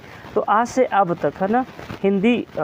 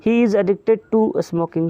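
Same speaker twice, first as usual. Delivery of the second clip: slow example reading; much slower than usual. Shown as a demonstration. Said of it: "reading example slowly".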